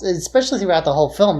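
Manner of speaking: ordinary delivery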